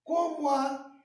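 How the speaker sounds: "Como há" high and joined as one run; linked-vowel scansion. Shown as a demonstration. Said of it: "você vê que eu digo".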